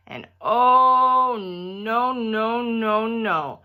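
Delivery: drawn-out dismayed wail of no; a kid's dread at the sight; horrified. Acted. Girl seeing the pilgrim dress, horrified wail.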